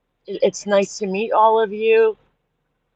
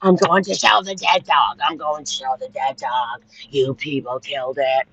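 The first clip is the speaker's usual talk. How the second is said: mock quoting the mother; 5 semitones lower than usual. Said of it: "because you said to him".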